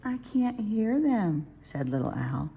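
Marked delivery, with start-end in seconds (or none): 0.0-1.5 put-on child voice. Little owl speaks.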